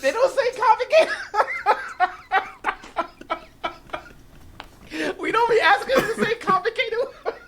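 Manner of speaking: high, loud laughing. Real, not acted. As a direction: laugh through every word. Laughing hard at bit.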